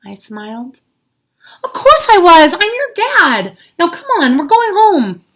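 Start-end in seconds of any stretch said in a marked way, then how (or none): 1.6-5.2 loud, high, firm reassurance; loving dad taking charge. A narrator's reading. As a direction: bring the child home. Father reassures child.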